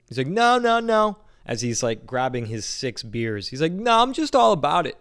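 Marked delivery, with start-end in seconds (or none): 0.3-1.2 much higher and louder, dismissive; goofy stranger voice. Retold or imitated. Stranger brushing off the question, high loud goofy voice. 3.7-5.0 much higher and louder, dismissive; goofy stranger voice. Retold or imitated. Stranger brushing off the question, high loud goofy voice.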